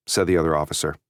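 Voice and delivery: ordinary delivery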